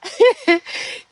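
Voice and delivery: squealing laugh that falls away; high burst of laughter. Real, not acted. High laugh over forgetting the conversation. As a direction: let the laugh break out high. Laughing at her own mixup.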